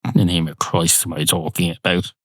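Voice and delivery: normal talking voice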